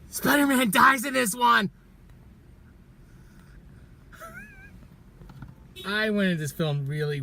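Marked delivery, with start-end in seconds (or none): 0.1-1.7 high, loud fake-alarm blurt; mock spoiler shout. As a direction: yell the spoiler like a prank.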